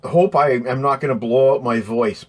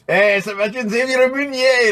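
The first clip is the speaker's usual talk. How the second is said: silly high call; 11 semitones higher than usual. Pretend. Calling out to a chat viewer.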